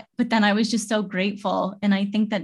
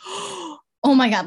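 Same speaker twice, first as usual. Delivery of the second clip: high, drawn-out startled exclamation; slow "oh my God" pitched up in alarm; retold shock; 8 semitones higher, 3 dB louder, slower than usual. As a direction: relive the sudden fright. Retells nearly hitting people with car.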